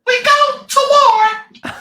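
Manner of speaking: very high, slow squeaky pirate line; a big pirate with a tiny high voice; surprisingly high voice. Retold or imitated. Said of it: "The biggest guy in the room gets up".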